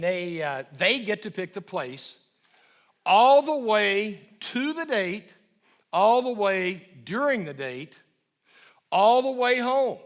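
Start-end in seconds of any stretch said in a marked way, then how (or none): 3.1-10.0 louder and higher with each "all the way"; emphatic building insistence. Shown as a demonstration. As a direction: drive it home, louder each time. Urges parents on the monthly date.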